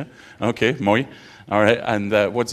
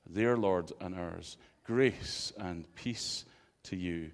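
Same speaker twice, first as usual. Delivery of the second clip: scripture reading; 5 dB quieter than usual. Acted. Reads the scripture aloud.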